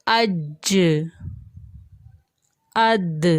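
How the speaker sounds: اج and اد closed with a rebounding release; qalqala bounce. Shown as a demonstration. Teacher shows letters springing back: rebounding stops. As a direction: let the j and d echo back. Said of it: "سب کی آواز واپس پلٹ رہی ہے".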